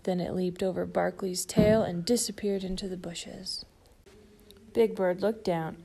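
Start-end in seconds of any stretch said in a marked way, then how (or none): none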